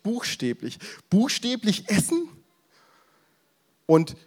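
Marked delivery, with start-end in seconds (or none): 1.1-2.3 aghast question. Retold or imitated. Crowd aghast at eating flesh.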